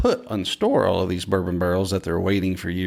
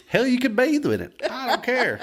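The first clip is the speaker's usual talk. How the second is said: playful exaggeration. Real, not acted. Joking bathe in bourbon.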